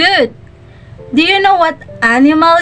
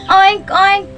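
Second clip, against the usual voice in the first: high nasal-free oink oink; pig oink; 5 semitones higher than usual. Shown as a demonstration. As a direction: grunt like a piglet. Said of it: "what the sound of a pig".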